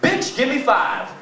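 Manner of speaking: hammed-up pushy demand; cocky food request; demanding tacos. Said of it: "I'm like".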